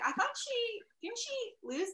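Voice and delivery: high-pitched confused spectator; puzzled audience member. Retold or imitated. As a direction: imitate a baffled onlooker, pitched high. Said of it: "someone in the audience was like".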